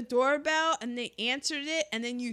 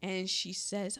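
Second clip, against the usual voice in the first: normal talking voice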